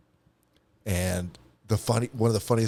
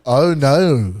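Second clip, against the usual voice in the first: loud mock Australian; chiming in with the Australian phrase; Australian accent; 5 semitones higher, 9 dB louder than usual. Host joining the Hugh Jackman line, Australian accent. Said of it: "directly from Hugh Jackman".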